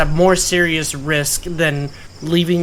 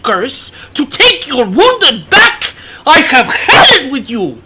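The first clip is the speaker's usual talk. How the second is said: raised, high-pitched outburst with bitter laughs; angry speech; 10 semitones higher, 4 dB louder than usual. Acted. August shouts at Captain Breakbone in rising anger. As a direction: let the captain have it, pitch climbing. Character confronts captain angrily.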